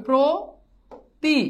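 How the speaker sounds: প্রতি drawn out slowly as "pro... ti" with a clear o; teacher slowly sounding out প্রতি with an o after pr; syllable-by-syllable demo. Shown as a demonstration. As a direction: stretch প্রতি into two separate syllables, "pro" then "ti". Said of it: "ওকার হবে, তাহলে কি হবে?".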